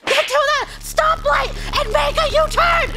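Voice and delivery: high, frustrated mock yell; exasperated yelling. Daughter answering mom who can't make a U-turn, exasperated. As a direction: shriek the directions, close to laughing. Retold quarrel with driver mom.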